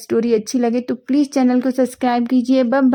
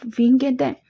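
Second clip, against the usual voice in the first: plain reading voice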